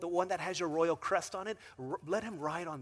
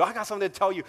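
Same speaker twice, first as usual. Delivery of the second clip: eager blurt; 5 semitones higher, 12 dB louder, much faster than usual. Retold or imitated. Acted quotes in Esther retelling.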